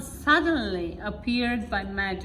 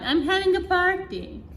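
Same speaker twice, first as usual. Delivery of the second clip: higher, excited hostess; little Miss Fun calling out in a high voice; 8 semitones higher than usual. A narrator's reading. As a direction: share the party news with glee. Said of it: "she cried".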